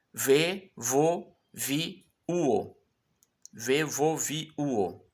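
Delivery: vê, vô, vi, uo as separate beats; drill reading. Shown as a demonstration. Teacher drilling the syllables vê, vô, vi, uo. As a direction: read the drill syllables one by one. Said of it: "combinações que eu faço aqui pra gente exercitar".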